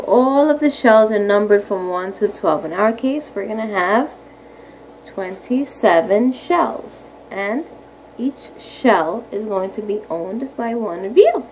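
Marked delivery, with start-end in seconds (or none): none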